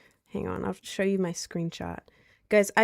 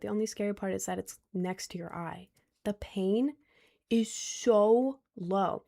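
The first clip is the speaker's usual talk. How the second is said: ordinary delivery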